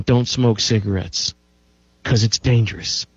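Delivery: lightly voiced radio announcer warning; a hint of an old radio warning. Host pretending to be on nineteen-sixty radio, lightly voiced warning. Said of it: "I'd be on the radio saying".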